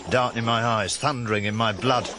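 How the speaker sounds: usual speaking voice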